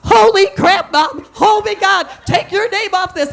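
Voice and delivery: recoil, alarmed and loud; son's panic. Retold or imitated. Son tells mom to take his name off, loud alarm.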